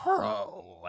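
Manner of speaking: high, drawn-out groan; groaned chorus. Acted. Birds echoing in synchronicity, high groan. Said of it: "and groan it out again".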